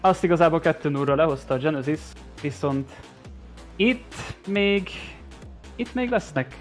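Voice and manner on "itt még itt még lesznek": excited build-up. Real, not acted. Hype for game three.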